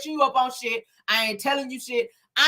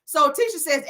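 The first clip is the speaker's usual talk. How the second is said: ordinary talk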